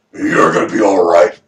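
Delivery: deep, loud put-on man's voice; bald man's reassurance. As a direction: drop low and boom the reassurance. Bald man's line voiced again.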